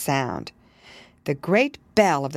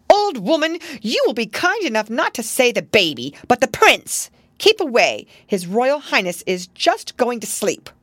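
high, loud, haughty scolding; frightened, sharp tone; 6 semitones higher, 5 dB louder than usual. A narrator's reading. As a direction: order the old woman off sharply. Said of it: "speaking in a much sharper tone".

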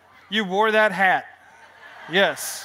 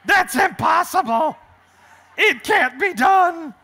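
much higher, laughing mock disbelief; mock astonishment; 8 semitones higher, 3 dB louder than usual. Crowd reacting to the impossible stunt, hammed-up disbelief. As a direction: gasp it out like a stunned crowd.

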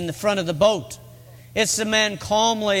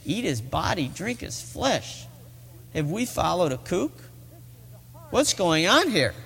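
mildly grumbling disciples, softer at first; 4 dB quieter than usual. Acted. Disciples muttering over a hard teaching, understated grumbling. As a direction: grumble in puzzled disbelief. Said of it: "they began to grumble and say".

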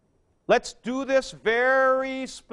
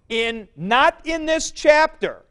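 chapter stressed high and loud; teacher stressing a point; emphatic correction; 6 semitones higher, 4 dB louder than usual. Shown as a demonstration. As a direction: insist on it. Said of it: "I want to emphasize this point".

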